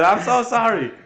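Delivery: imitated panicked pleading, pitched high and loud; frantic apology. Captain mimics the delirious recruit apologizing, high frantic pleading. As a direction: gush out the sorry like a terrified recruit. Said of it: "screaming, talking, just trying to apologize".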